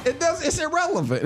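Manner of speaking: laughing through the words. Real, not acted. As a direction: brush it off with a chuckle. Laughing off the garbage touchdown.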